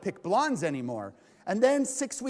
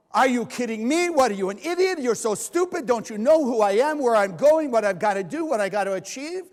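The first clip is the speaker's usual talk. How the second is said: supermarket rant; 2 semitones higher, 7 dB louder than usual. Retold or imitated. Shopper yelling at the customer with too many items, hammed-up fury. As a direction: blow up at the idiot ahead in line.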